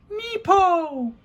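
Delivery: put-on very high baby squeal; a toddler calling out sharply; baby's cry. A narrator's reading. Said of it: "Sunny's unmistakable voice rang out".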